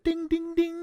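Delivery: high sung ding ding ding; game show bell. Pretend. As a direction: chime like a quiz-show bell. Game-show bell for right answer.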